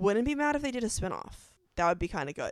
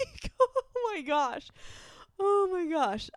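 high laughing oh my gosh; 10 semitones higher, slower than usual. Real, not acted. Fan laughing at being a crybaby, high and giggly. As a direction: giggle through it, embarrassed.

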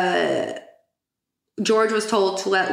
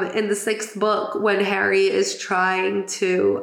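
normal talking voice

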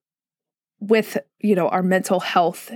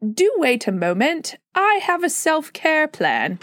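a light put-on British accent, rising high at the end; half-hearted British accent, pitch lifting on the last line; mock British accent; 6 semitones higher than usual. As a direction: tease with a prim British accent. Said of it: "they're not gonna say it in that accent".

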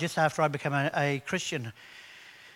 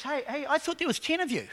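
voicing Jesus; 9 semitones higher, 3 dB quieter than usual. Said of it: "And Jesus says".

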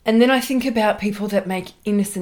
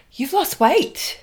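gushing compliment; 9 semitones higher, 4 dB louder, much slower than usual. Retold or imitated.

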